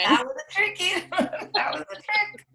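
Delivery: high-pitched laughing words; laughing at the trick word. Real, not acted. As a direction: laugh through it, amused.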